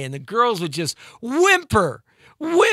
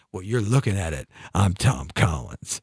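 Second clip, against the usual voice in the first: smooth punchline; 11 semitones lower, 4 dB quieter than usual. Retold or imitated. The guy at the bar reveals himself to the girl, low and suave.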